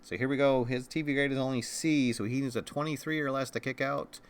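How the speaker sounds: ordinary talk, nothing marked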